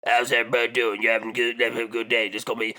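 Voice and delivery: low mumbled captain impression; lightly voiced mumbling ferry captain; imitating the captain. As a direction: mumble like a backwoods boat captain. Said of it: "This is how he talked".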